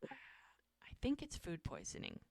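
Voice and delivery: hushed whisper, like sharing a secret. Retold or imitated. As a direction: drop to a breathless whisper for the diagnosis. Doctor confides a diagnosis.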